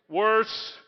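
usual speaking voice

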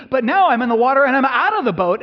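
frantic rush of fear; panicked. Acted. Peter's panic thoughts.

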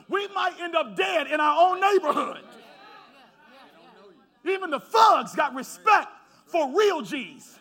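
loud, building pulpit cry with long held words; preaching at full force. Acted. Pastor to congregation urging action, preaching at full force. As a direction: preach it up until the voice cracks.

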